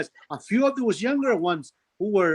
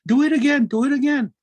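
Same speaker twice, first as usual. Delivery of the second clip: higher, louder chanted taunt; taunting birds; 6 semitones higher, 6 dB louder than usual. Acted. Birds would taunt the others to do it again, taunting. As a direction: egg them on in a raised voice. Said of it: "these other birds would taunt them".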